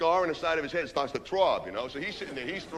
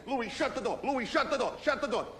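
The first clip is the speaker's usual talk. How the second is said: urgent pleading; 6 semitones higher than usual. Retold or imitated. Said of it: "I go".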